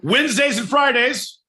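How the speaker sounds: high, loud mock correction; joking shout. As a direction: call it out loud.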